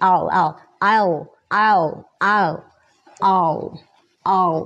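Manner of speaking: repeated model "I'll", collapsed to one sound; L-heavy I'll. Shown as a demonstration. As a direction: merge "I'll" into one syllable led by the L. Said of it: "มันก็เลยเหมือนเขาพูดเป็น".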